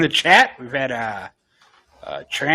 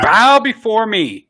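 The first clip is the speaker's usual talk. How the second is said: slow, louder, commanding; dark lord; 6 semitones higher, 4 dB louder than usual. Pretend. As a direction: decree it like a tyrant. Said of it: "commands bowing".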